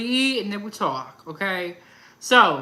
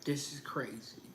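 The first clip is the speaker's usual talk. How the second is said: much softer, under the breath; muttered aside; 5 semitones lower, 15 dB quieter than usual. Retold or imitated. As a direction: say it almost to yourself. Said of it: "and I was like".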